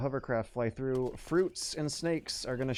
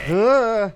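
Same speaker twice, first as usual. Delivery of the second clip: sudden loud startled cry; startled yelp; 8 semitones higher, 14 dB louder than usual. Real, not acted. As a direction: jump and yelp in fright. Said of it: "Sorry, I'm extra jittery".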